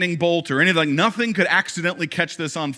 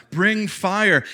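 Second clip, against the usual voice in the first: higher, louder cry to a false god; mock scream; 6 semitones higher, 4 dB louder than usual. Prophets of Baal calling on their god, a hammed-up shout. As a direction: cry out to the idol, half-performed. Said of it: "they start screaming out to their".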